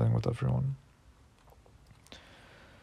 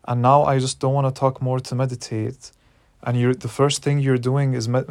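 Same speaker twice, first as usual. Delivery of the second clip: retold angry outburst; 2 semitones higher, 4 dB louder than usual. Retelling snapping at her meditation teacher, loud and furious. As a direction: shout back at the threat, outraged. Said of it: "really, really mad at her".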